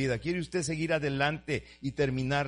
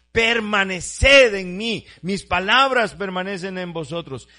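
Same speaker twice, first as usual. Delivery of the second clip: loud, higher, slow call; full-force preaching; 6 semitones higher, 12 dB louder, slower than usual. Acted. Preacher calls the verse out to his flock, loud and slow. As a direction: ring the verse out across the church.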